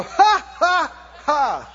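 loud, deliberate "ha, ha, ha"; big staged scornful laugh; defiant laugh. Shown as a demonstration. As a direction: laugh right in its face. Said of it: "laugh at it and go".

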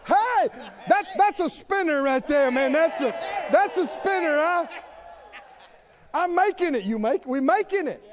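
high, loud happy shouts; a survivor shouting with glee; joyful survivor. Acted. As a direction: whoop like you just escaped death. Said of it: "Then you go".

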